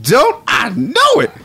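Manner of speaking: high, drawn-out falsetto; stretched cartoonish high cry; Hungry Dude. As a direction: squeal it slowly as an excited dude. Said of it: "now Hungry Dude is".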